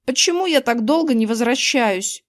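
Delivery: usual speaking voice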